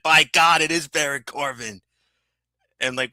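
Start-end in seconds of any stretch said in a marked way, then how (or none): 0.0-1.8 lightly imitated wrestling commentator; raised exclamation, a hint of ringside call. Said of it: "you pulled a J R and said".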